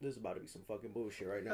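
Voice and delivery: fast, low, resigned self-talk; quick quiet remark to yourself; low aside. Retold or imitated. Pool worker braces for trouble, low aside. Repeats warning to himself.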